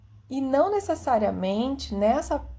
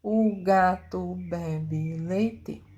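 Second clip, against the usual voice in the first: O gato bebe leite said very slowly as one joined stream; like a child blending the whole sentence together; slow, childlike, words merged; run together slowly; 3 semitones lower, much slower than usual. Shown as a demonstration. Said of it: "ela vai fazer o seguinte".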